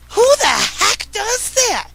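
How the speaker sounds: outraged disbelief. Real, not acted. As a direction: pitch shoots up, slow and outraged. Outraged at husband.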